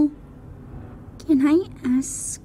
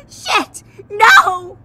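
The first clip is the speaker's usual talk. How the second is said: higher, louder cry; panicked yell; 7 semitones higher, 8 dB louder than usual. Acted. Sadie yelling as the extinguisher dies. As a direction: shout in alarm. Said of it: "She yelled".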